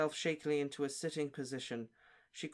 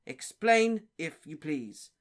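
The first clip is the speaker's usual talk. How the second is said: stern official; 7 dB louder, much slower than usual. A narrator's reading.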